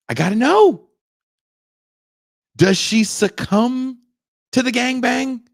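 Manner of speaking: high, loud desperate need to know; frantic suspense. Real, not acted. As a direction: squeal it out, dying to find out. Frantic suspense watching the show.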